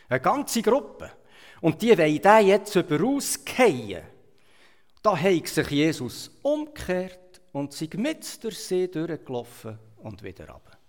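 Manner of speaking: usual speaking voice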